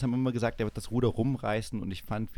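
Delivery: ordinary talk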